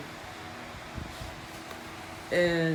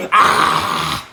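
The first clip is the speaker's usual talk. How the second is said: low unvoiced grunt of alarm; a breathy startled huff; scared gasp running off; low cry. Kid scared off.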